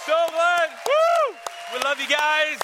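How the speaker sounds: high, loud whoop to the crowd; excited cheering. Real, not acted. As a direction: cheer along with the congregation.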